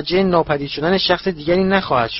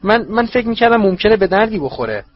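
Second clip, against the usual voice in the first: higher, nervous stammer; stammering servant; 8 semitones higher, 4 dB louder than usual. Acted. Wormtail caught lying, stammering higher to his master. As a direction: squirm out of the lie. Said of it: "ورمتیل گفت".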